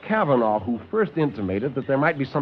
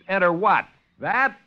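higher, louder disbelieving yelp; incredulous; 7 semitones higher, 4 dB louder than usual. Acted. Lieutenant balks at the doctor's door, incredulous and high. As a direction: balk at the strange door.

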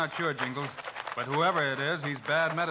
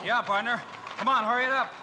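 urgent call; 7 semitones higher than usual. Acted. Urges partner on amid danger.

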